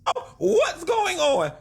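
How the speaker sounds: very high, drawn-out mock bewilderment; slow wail in a badly put-on reality-star voice; Portia impersonation. Commentator playing baffled about the drama, in a high, slow impersonation. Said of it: "That was a bad Portia impersonation".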